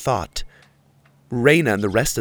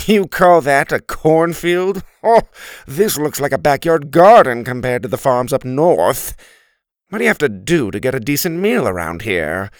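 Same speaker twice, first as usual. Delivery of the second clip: louder, scornful turkey complaint; scoffing turkey; 3 semitones higher, 6 dB louder than usual. Acted. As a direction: sneer at the crops. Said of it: "he'd say".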